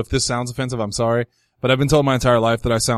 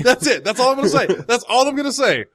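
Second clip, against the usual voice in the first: higher, giggly "that's all I'm gonna say"; laughing refusal; 10 semitones higher than usual. Real, not acted. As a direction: cut the story off through laughter.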